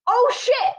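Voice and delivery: high panicked oh shit; dismayed curse. Retold or imitated. Blurts curse into mic.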